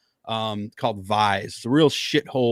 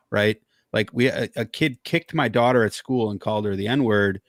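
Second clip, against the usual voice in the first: normal talking voice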